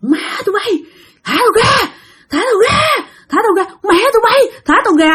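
crying out in fury, voice cracking high; possessed cursing. Retold or imitated. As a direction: shriek curses like a possessed woman fighting the ropes. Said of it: "cái giọng ồn ồn như đàn ông mà chửi thề".

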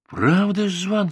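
high, alarmed question; frightened question. A narrator's reading. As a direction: ask it in a startled, high-pitched fright. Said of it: "спросил я испуганно".

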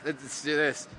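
usual speaking voice

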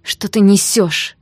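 loud, slow, indignant question; cried out. A narrator's reading. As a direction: shout it in disbelief. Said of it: "выкрикнула я".

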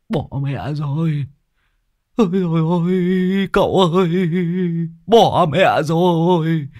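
high, drawn-out wail of doom; theatrical high moaning, last words stretched; panicked wailing. Acted. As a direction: lament like a man sure he's ruined. Thief panics at seeing the crowd.

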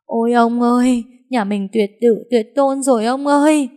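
high, loud wailing lament. Acted. Wife wailing to her husband about no heirs, loud wail. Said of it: "mếu máo khóc to".

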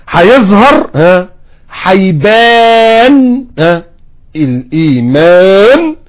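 high, loud, stretched out; preacher hammering the point; emphatic drawn-out climax. Real, not acted. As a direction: drag the word out for effect.

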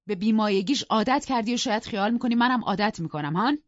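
outraged husband telling off; angry scolding. Acted. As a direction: berate your wife, building to a sharp challenge. Husband berates wife over guest's manners.

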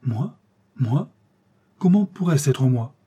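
innocent questioning, "Moi?" sweeping sharply upward; mild astonished denial; simple exclamation. A narrator's reading. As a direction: protest simply, as if surprised. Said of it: "il s'écria simplement".

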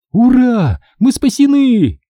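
high-pitched joyful cheer; happy. A narrator's reading. Sherry announcing to friends that they are saved, happy. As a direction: cheer it happily, voice soaring. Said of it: "счастливо объявила Шерри".

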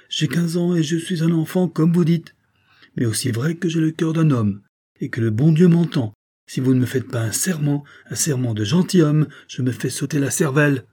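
put-on high, loud, determined boy; impassioned threat. A narrator's reading. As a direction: swear it with desperate resolve. Boy threatens suicide to force oath.

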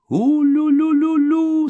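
very high, loud ululating sound; long, piercing "lu-lu-lu" hoot; spaceship farewell warble. A narrator's reading. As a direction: imitate a departing flying saucer. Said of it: "сказал корабль на прощание".

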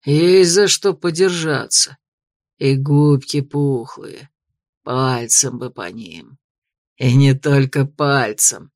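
slow, low, greasy leer. A narrator's reading. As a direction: savor each word lecherously. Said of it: "сально улыбнулся бугай".

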